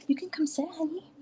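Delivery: usual speaking voice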